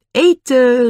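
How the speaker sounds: high, loud call. A narrator's reading. Annie summons the others to the food, calling out. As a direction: call everyone over to eat. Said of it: "riep ze".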